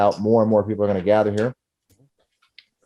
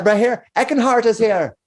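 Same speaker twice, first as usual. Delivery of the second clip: barmaid calling out; 10 semitones higher than usual. Acted. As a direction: call out to the room. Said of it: "she goes out".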